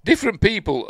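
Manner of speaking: put-on a light German accent. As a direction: start his German accent again. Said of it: "That's how he talks".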